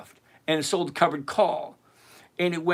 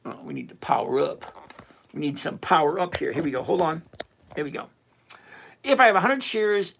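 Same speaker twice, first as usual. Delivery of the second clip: usual speaking voice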